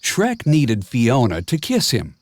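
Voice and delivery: ordinary delivery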